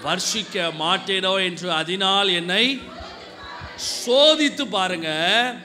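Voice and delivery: slow, loud, proclaiming each phrase; emphatic scripture reading. Acted. Preacher reading Malachi to the church, slow loud proclamation.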